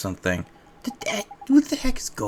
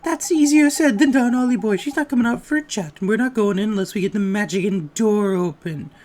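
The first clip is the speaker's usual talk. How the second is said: higher, loud, excitable little sidekick; high cheeky fairy voice; 11 semitones higher, 5 dB louder than usual. Acted.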